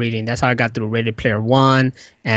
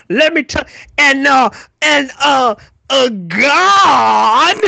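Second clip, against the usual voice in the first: high, loud, crying preacher build; rising impassioned oratory; 13 semitones higher, 6 dB louder, slower than usual. Pretend. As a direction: belt it like a fired-up preacher. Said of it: "extreme preacher".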